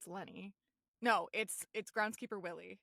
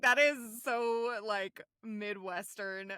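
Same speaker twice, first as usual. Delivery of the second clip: slow drawn-out; 8 dB louder, much slower than usual. Retold or imitated. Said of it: "I was like".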